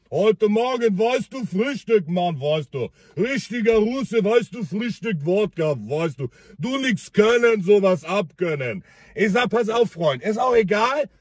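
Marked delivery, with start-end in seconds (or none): 0.1-8.8 put-on lower, slurred Russian accent; drunk Russian accent. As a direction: drunk Russian brushing off the police. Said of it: "Mit so einem russischen Akzent". (tiefer betrunkener russischer Akzent)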